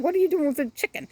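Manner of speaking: quick, high, startled call to the duck; high, fast mock-surprised what are you doing; surprised question. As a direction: pretend you just caught the duck carrying off a chick. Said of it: "I was like".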